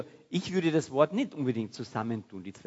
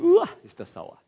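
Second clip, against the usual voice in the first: high, loud yelp of disgust at a sour bite; acted "yuck" outburst, pitched way up; disgusted sour reaction; 10 dB louder than usual. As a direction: recoil out loud from something sour. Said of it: "dann beißt du rein und sagst".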